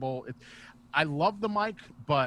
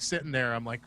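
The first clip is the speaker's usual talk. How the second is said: usual speaking voice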